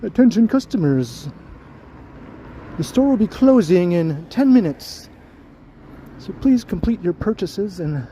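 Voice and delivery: mock store PA announcement. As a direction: parody a closing-time PA announcement. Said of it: "Gonna have those announcements going".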